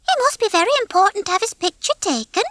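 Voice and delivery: very high-pitched, simple hen voice; naive hen Babs. A narrator's reading. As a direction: muse in a high little voice.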